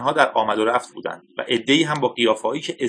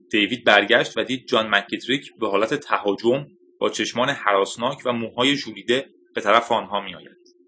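usual speaking voice

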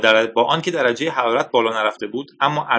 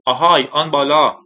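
raised, louder hail; calling out; 4 semitones higher, 6 dB louder than usual. A narrator's reading. Scientist hails the command balcony, calling out loudly. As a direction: call up to someone overhead. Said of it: "فریاد زد".

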